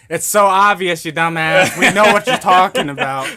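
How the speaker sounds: loud, scornful jeer from viewers; loud mock yell at a clueless host; mock exasperated yell. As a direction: shout it like the answer is obvious. Imagined viewers mocking the speaker.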